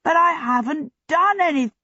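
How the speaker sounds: high, indignant outburst; protesting. A narrator's reading. Prisoner objecting to talk of crucifixion, high protest. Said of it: "protested Abdullah".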